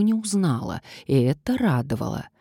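usual speaking voice

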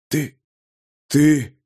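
halting, choked stammer; struggling to get sounds out; coughed-out words. A narrator's reading. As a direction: force each word out with effort. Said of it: "Ворон с трудом выкашливал звуки".

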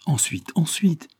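hushed impatient prodding. A narrator's reading. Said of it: "écoute avec impatience".

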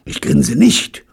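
high, loud indignant denial; annoyed. Acted. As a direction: snap back, offended. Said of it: "ärgerte sich Robert". (verärgert, höher und laut)

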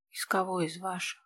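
barely manage the question; quiet weak question. Acted. Exhausted wounded man asks. (тихо, без сил)

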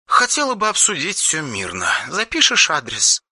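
put-on a soft, hesitant girl on the phone; Yulia's voice. Yulia to the hero on the phone, lightly voiced and hesitant.

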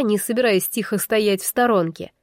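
ordinary delivery

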